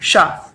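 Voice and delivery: curled retroflex शा, tongue bent up like ट; retroflex sha demo. Shown as a demonstration. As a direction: say शा with tongue curled back to the roof. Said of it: "क्या बोल के देखेंगे?".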